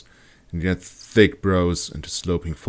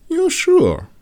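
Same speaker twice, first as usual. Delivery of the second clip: concerned; 6 dB louder than usual. Acted. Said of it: "looking concerned".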